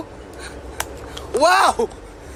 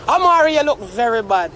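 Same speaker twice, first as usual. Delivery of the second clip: ordinary talk, nothing marked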